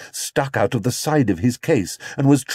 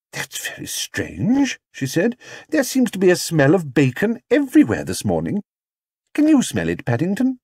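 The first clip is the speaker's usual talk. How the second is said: suspicious Mrs Brown; 8 semitones higher than usual. Acted. Mrs Brown questioning Paddington, suspicious. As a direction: sniff out the bacon.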